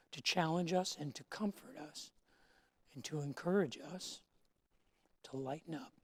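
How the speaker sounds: soft, hushed prayer to God; quiet pastor's prayer, soft and reverent. Acted. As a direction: pray it gently and quietly. Opening prayer before sermon.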